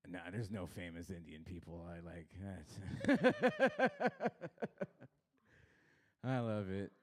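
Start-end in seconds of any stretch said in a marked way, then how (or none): 0.1-2.8 softer, low muttered aside; quiet throwaway. Real, not acted. 2.8-5.0 high burst of laughter; loud giggling fit; real laugh. Real, not acted. Laughs at own joke.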